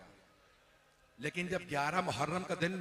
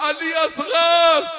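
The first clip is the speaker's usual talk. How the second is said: high, slow, sung wail, much louder; lamenting cry; 11 semitones higher, 13 dB louder, much slower than usual. Acted. The Imam mourns his infant son in a loud sung wail.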